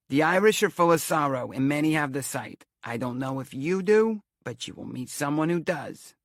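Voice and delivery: high, loud Romany seer; prophesy dramatically in a Romany accent; theatrical fortune-teller with Romany accent; thick Romany accent. A narrator's reading. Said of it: "she talked in a thick Romany accent".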